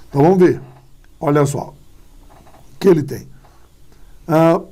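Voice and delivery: ordinary delivery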